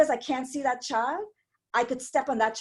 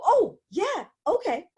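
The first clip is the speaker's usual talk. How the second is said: laughing, sheepish; 11 semitones higher, slower than usual. Retold or imitated. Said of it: "And I go".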